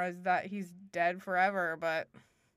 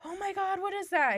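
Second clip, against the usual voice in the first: high-pitched startled wife; acted alarm; 11 semitones higher than usual. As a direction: play sudden fright at a noise. Wife hears knock at door.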